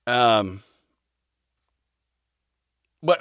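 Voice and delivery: ordinary delivery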